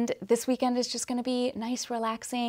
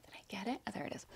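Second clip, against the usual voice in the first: muttered whisper to herself; 17 dB quieter, much faster than usual. Real, not acted. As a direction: whisper it under your breath. Checking for fur in mouth.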